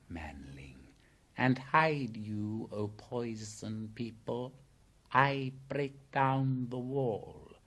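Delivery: slow, drawn-out snake speech; python voice. A narrator's reading. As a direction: speak as a deliberate, commanding python. Python speaks, then orders all back.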